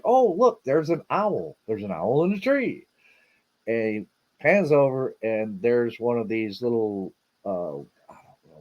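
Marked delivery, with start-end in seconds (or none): 0.0-2.8 higher-pitched mock excitement; excited retold exclamation. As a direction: imitate his excited sighting. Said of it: "he was like".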